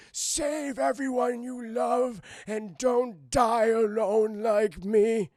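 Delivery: high, slow, loud strained deathbed plea; drawn-out melodramatic dying old man; dying grandpa. Acted. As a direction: wail out last words in hammed-up agony. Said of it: "before I pass on".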